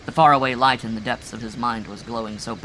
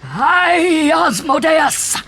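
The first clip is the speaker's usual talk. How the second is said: long, slow, very high and loud scream; 12 dB louder, much slower than usual. A narrator's reading. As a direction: shriek the name to the heavens. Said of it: "he screamed".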